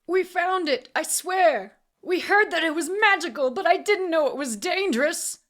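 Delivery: high, loud, desperate plea; fighting back tears. A narrator's reading. Man swearing to his interrogator, tearful panic. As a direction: plead frantically, close to tears. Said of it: "clearly fighting back tears".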